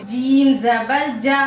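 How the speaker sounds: जीम जबर जा said slowly and lightly; careful Quran letter drill; slow thin letter drill. Shown as a demonstration. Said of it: "बारीक पढ़ेंगे".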